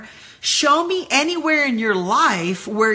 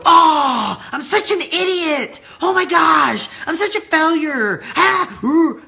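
louder, higher mock panic at herself; mock self-scolding; 5 semitones higher, 4 dB louder than usual. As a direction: ham up beating yourself up over a mistake. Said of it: "I didn't instantly go".

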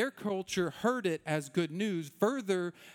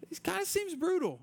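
much higher-pitched, tentative objection; hesitant doubt; 12 semitones higher than usual. Retold or imitated. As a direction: voice a doubt in a small high voice. Voicing his doubtful reaction to scripture.

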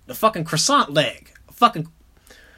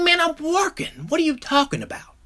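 annoyed; 9 semitones higher than usual. Retold or imitated. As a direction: brush off your friend on the phone. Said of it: "I'm like".

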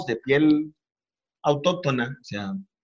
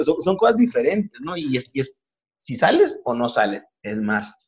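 usual speaking voice

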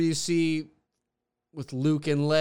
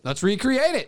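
higher, louder, hyped-up repeat; excited; 8 semitones higher, 6 dB louder than usual. Real, not acted. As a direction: sell the dumb plan with glee.

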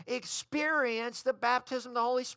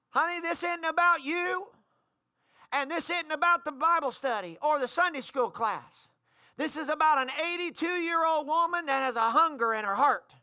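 higher, emphatic correction of a relative; insistent rebuttal; 4 semitones higher, 4 dB louder than usual. Retold or imitated. Old lady setting her niece straight, insistent rebuttal. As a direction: press each point home, building in force.

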